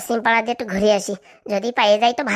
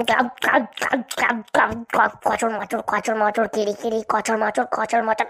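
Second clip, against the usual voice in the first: gulping, then crunching mouth sounds, low and slow; mock chewing noises; 3 semitones lower, much slower than usual. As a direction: chew noisily into the mic like snacking on a side dish. Gulping and chewing after a drink.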